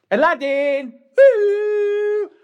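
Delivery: high, loud call for a lost son. Acted. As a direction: shout the name across the hall.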